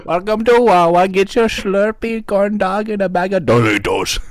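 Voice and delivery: exaggerated Indian shopkeeper accent. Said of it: "racial accents".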